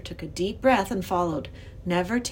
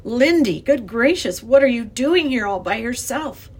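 startled exclamation, higher and louder; 4 semitones higher, 7 dB louder than usual. A narrator's reading. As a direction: exclaim in surprise at finding someone. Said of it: "He looked startled".

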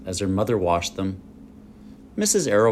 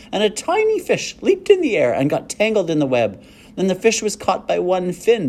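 excited; 8 semitones higher, 4 dB louder than usual. A narrator's reading. Fern tells excitedly.

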